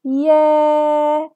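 يا held loud for four counts, open and long; teacher holding the long alif vowel. Shown as a demonstration. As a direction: stretch يا for four beats. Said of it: "هنطول في صوتنا أربع عدات".

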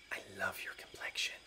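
breathy whisper of a compliment; soft unvoiced admiring whisper. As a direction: lean in and whisper it. [name] leans in, whispered compliment.

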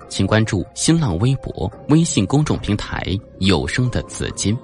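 plain reading voice

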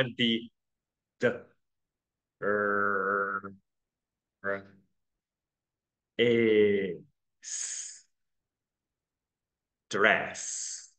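slow, stretched sounds building up to "dress"; broken-down pronunciation. Shown as a demonstration. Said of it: "你看啊".

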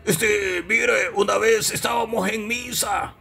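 drawn-out comic storyteller; puppet storyteller. Acted. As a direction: begin the tale in an unhurried puppet voice. Puppet Deca starts the joke.

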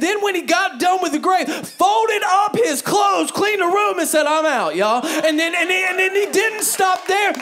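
loud, high, driving sermon delivery; full-volume pulpit preaching; preaching at full force. Acted. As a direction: preach it out to the whole room.

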